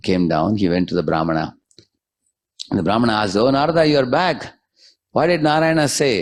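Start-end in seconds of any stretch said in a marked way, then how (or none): 3.4-6.1 high, eager questions; pitched up, expectant host; eager greeting. Acted. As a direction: lean in, keen for the news. Brahmin eagerly greets returning Narada.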